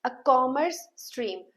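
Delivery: "A commerce stream" drawn out very slowly again; slow model phrase. Shown as a demonstration. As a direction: repeat it slowly. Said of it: "I hope I am very clear to you". (फिर से धीरे खींचकर बोलना)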